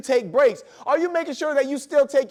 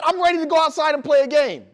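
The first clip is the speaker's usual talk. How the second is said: put-on higher, louder kid voice; eager child; 6 semitones higher, 4 dB louder than usual. Son begs dad to go outside, eager kid voice. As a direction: ask like a boy bursting to play.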